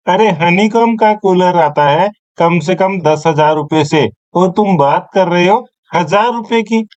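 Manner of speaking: normal talking voice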